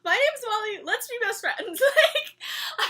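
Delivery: high, loud, bubbly hello; overeager greeting. Pretend. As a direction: gush like the weird girl who won't leave them alone. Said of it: "I'd be like".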